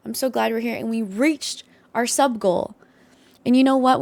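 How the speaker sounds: ordinary talk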